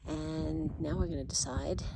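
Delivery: ordinary talk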